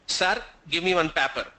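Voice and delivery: put-on Telugu-accented English, "Sar" and "papper". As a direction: imitate a Telugu speaker's English. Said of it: "They pronounce that as paper".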